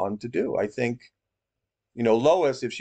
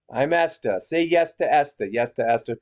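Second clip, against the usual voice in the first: higher-pitched mimicry in a New York accent; mock New York accent; 3 semitones higher than usual. Mocking a false prophet's self-naming, put-on New York accent. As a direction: imitate her New York "Esther". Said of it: "She calls herself".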